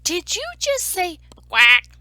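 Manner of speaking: loud, incredulous question; mock disbelief. Story narrator confronting the duck, loud mock disbelief. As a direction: challenge the culprit loudly.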